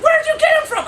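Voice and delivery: high, loud squealed question; exaggerated amazement. Coffee girl asking about the monkey, mock-amazed. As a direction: squeal it in comic disbelief. Worker astonished, questioning him.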